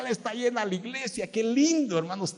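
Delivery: high, delighted cry over a full church; excited joy. Retold or imitated. Pastor retelling his joy at a full church, excited and high. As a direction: burst out happy at the crowd.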